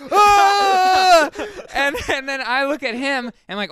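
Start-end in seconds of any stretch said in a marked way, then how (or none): 0.1-1.3 high, loud startled scream; retold scream. Telling a friend how his brother woke screaming, startled shriek. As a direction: let out a sudden shriek of fright. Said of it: "he gets up in bed and just screams".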